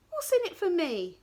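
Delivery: high, loud cheeky demand; bargaining. Acted. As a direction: ask it like a sharp trader. Princess bargains.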